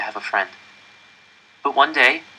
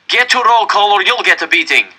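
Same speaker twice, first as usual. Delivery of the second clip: loud, high threat; a guard threatens the boy; threatening; 10 semitones higher, 6 dB louder than usual. A narrator's reading. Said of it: "the capo threatened me".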